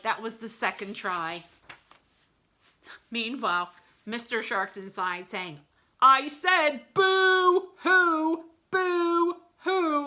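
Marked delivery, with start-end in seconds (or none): 6.0-10.1 much higher, louder, very slow insistent fake sobs; exasperated falsetto fake crying; impatient boo-hoo. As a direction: stress the code word falsetto. Shark repeating code word.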